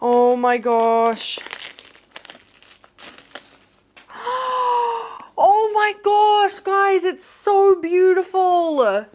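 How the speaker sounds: high, loud, slow overwhelmed gushing; gush, overwhelmed with emotion; emotional. Real, not acted. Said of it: "Why do I feel emotional?".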